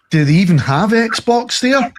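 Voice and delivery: high-pitched imitation of an ignorant gamer; high mocking quote; mock clueless question.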